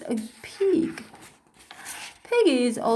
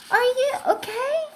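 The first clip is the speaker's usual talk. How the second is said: worried friend; 12 semitones higher, faster than usual. Acted. Worried friend checks on Gerald.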